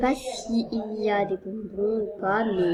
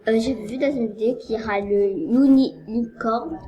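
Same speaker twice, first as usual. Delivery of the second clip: ordinary talk, nothing marked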